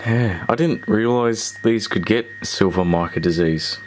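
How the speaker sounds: ordinary talk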